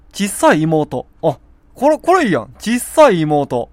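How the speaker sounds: loud, high delight at a new idea; light up as the idea clicks; excited discovery. Real, not acted. Pleased with his new name for a sore throat, excited.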